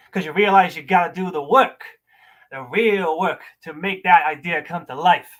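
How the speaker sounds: slow, theatrical dressing-down with wide pitch swings; scornful monologue. Acted. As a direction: berate someone in measured, acted bursts, punching key words. Performs audition monologue.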